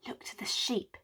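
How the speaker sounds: conspiratorial unvoiced whisper. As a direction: whisper it so Mac can't hear. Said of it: "to each other they whisper".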